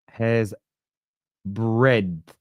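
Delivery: "breadth" slowly, sounding out the D; careful pronunciation. Shown as a demonstration. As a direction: sound out "Hair's breadth" word by word, with the D. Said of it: "I just don't pronounce the D".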